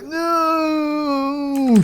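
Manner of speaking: very high, long squealed no; mock scream. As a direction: stretch out a squeal of defeat. Villain's final cry of defeat.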